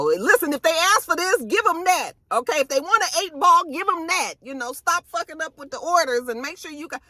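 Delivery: high, bossy voice giving orders; bossy Effie. Retold or imitated. Effie bossing warehouse workers.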